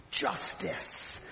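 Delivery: hushed, drawn-out mock triumph; whispered relish, acted out; gloating whisper. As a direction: savour the word in a gleeful whisper. Acted gloating at speeder's ticket.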